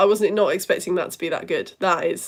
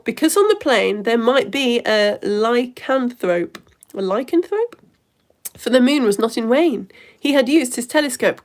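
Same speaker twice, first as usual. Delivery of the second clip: poem reading; 2 semitones higher, 5 dB louder than usual. Acted. Host reading Edmund's poem to viewers, loud recital. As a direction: give the limerick a grand reading.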